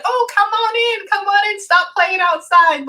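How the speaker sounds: high, sustained calling-out voice; imitated singsong call from the doorway; mother calling child in. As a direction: call the kids indoors, voice held high. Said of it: "it's almost like she's like".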